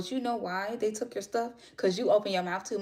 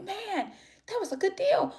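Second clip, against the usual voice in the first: squeaky, much higher whine over the lost deal; wistful high voice; 14 semitones higher, 3 dB louder than usual. Retold or imitated. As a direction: pitch it way up, like a pouty sigh. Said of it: "I was like".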